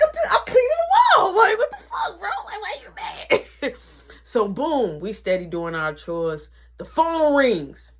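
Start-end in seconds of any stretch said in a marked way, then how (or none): 0.0-3.7 high, loud bewildered whine; confused protest. Retold or imitated. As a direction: protest like a wronged kid who didn't know. Mark confused and upset.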